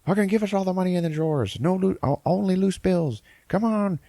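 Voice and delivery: high, fast mock yelling; imitating a pushy robber barking orders; hammed-up frantic demand. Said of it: "they're like".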